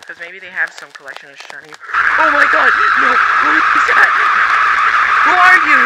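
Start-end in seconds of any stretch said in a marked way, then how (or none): 1.9-5.8 high, loud panicked yelling; terrified screaming. Acted. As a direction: scream in sheer terror. Sees a stranger, terrified.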